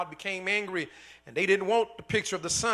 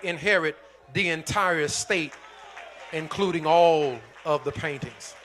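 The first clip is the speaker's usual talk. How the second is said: grows louder to a climax; building preaching; 6 dB louder than usual. Acted. Preacher reveals the will's secret to the congregation, building louder.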